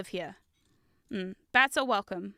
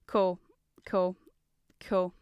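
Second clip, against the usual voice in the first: lower, flat-out ironic repeats; deadpan cool cool cool; 5 semitones lower, slower than usual. Real, not acted. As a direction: say it dry, like you meant it all along. Ironic after finding piece.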